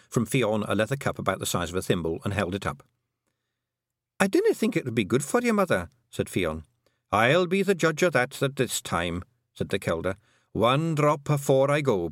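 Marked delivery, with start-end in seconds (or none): 4.2-5.9 worried daughter. Acted. 7.1-12.1 put-on high, Scots-accented old Pictsie matriarch; a tiny Scottish clan mother, proud and fond; old Kelder voice. The Kelder telling Tiffany about her sons, high and Scottish.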